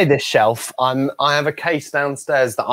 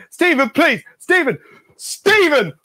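high-pitched mock begging; desperate pleading; 12 semitones higher, 3 dB louder, slower than usual. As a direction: call the name in rising exasperation. Performer desperately begging his friend.

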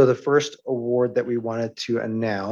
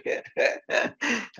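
soft unvoiced embarrassed laugh; breathy laugh; 12 semitones higher, 4 dB quieter than usual. Real, not acted. Embarrassed at choking up on stage.

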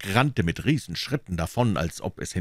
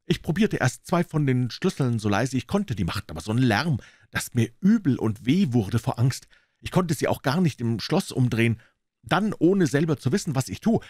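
put-on higher, lively boyish voice; excited boy; 6 semitones higher than usual. Tom telling Huck what he saw, excited boyish voice. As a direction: tell it breathlessly, still scared.

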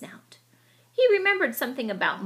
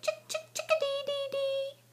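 very high whistled bird call; chickadee call; 5 dB quieter than usual. Acted. Chickadee calling from branch, high whistled call. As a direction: sing it like a chickadee. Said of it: "it called".

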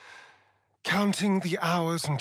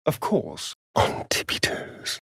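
sneaky whisper; 8 semitones lower than usual. Acted. Companion urging the party to sneak past foes, whispered. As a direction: whisper it, playful and hushed.

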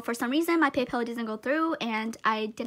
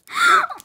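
very high, loud gasp; shocked gasp; 11 dB louder than usual. Real, not acted. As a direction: gasp sharply, truly stunned. Shocked by the gift.

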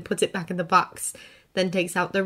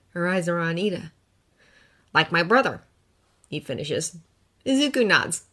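usual speaking voice

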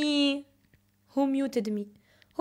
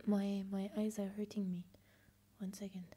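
much softer whispered aside; 6 semitones lower, 15 dB quieter than usual. Real, not acted. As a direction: whisper it away from the mic. Quiet complaint aside on stream.

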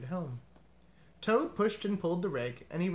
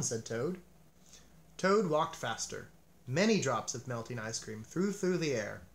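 usual speaking voice

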